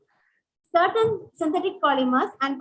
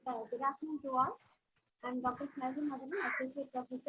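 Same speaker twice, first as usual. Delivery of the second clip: very faint, distant voice; faint voice, far from the mic; barely audible; 14 dB quieter than usual. Real, not acted. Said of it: "Her voice is very low".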